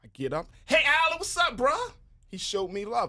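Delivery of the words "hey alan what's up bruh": high, eager hail; calling out. Retold or imitated. Man greeting an actor at Fridays, calling out. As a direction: hail him across the room, pitched way up.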